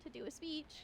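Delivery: ordinary talk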